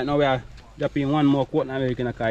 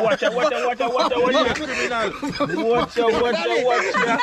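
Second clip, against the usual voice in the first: high, playful warning shout; 8 semitones higher than usual. Real, not acted. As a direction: call out watch out, half laughing. Calling warning across the kitchen.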